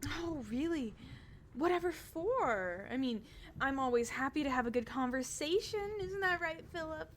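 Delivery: high, bubbly noble lady; cheerful Adela. Acted. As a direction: chirp it up like an eager hostess. Adela responds.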